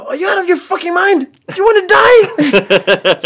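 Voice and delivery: high, loud disbelieving yell through laughter; shocked yell. Retold or imitated. Uncle yells at nephew for taking Greyhound, high and loud.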